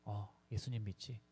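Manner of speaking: put-on a weak old man's murmur; faint answer. Son imitating his frail father answering, very soft.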